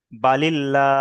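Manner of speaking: "বালিল্লা" held, with a light L and open a; thin L recitation. Shown as a demonstration. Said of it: "লা করে পড়তে হবে". ("বালিল্লা" বারিক লা দিয়ে টেনে)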